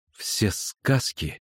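slow, drawn-out bitter words; through clenched teeth. A narrator's reading. Girl doubting talk of a fairytale land, through clenched teeth. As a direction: squeeze the words out slowly. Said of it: "процедила она".

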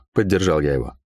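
usual speaking voice

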